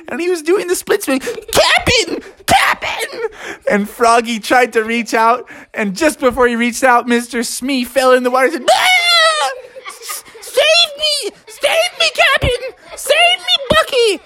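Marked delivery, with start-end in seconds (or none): 1.2-3.2 very high, squealing mock panic; panicked cry for help. A narrator's reading. Smee slipping, calls for help. 8.7-14.2 squealing, very high cartoon panic; panicked cry for help. A narrator's reading. Smee falls in, begs rescue.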